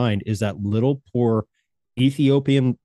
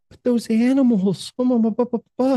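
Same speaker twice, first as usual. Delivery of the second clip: mock pleading wife, pitched way up; imitated wife; 10 semitones higher than usual. Husband mimicking wife upset by animal commercials, high mock plea. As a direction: imitate your wife in a much higher voice. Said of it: "She's like".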